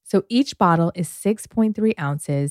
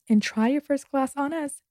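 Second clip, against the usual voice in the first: cheerful toast; 7 semitones higher, 5 dB quieter than usual. Real, not acted. Ad read sign-off toast.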